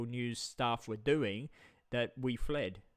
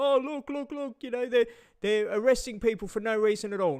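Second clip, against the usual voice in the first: high, whiny mock outrage, much higher and louder; mocking Rebel News voice; 9 semitones higher, 5 dB louder than usual. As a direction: sneer at them in a squeaky put-on voice. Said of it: "And then Rebel News would go".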